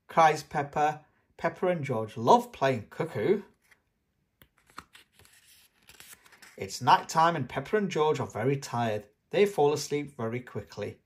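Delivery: put-on lively storybook reading for small children. A narrator's reading. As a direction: read it like a bedtime picture book. Reads a picture book aloud.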